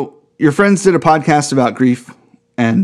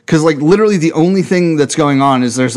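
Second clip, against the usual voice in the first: normal talking voice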